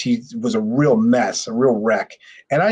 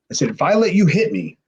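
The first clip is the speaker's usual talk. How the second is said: ordinary talk, nothing marked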